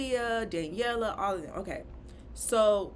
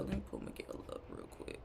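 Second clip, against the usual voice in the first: whispered, low and soft to herself; quick whispered note to self; whispered aside; 8 semitones lower, 11 dB quieter than usual. Real, not acted. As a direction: drop to a whisper as a side note. Aside while looking up a photo.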